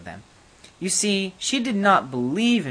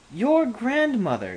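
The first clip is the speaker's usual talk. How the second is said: queen interrupting; 9 semitones higher, slower than usual. A narrator's reading. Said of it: "interrupted Her Majesty".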